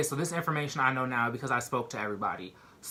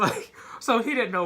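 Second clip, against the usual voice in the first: still laughing; 9 semitones higher, 4 dB louder than usual. Real, not acted. Still laughing at dad story.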